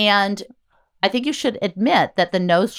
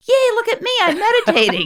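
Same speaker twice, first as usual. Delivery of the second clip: squealing high and excited; giddy self-mockery; 4 dB louder than usual. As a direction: cheer for yourself like a kid. Mocking her own excitement.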